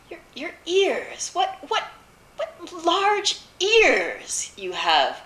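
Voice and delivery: high, halting, drawn-out amazement; stammer, then stretch it out high in wonder; astonished girl. A narrator's reading. Girl marvels at disguised wolf.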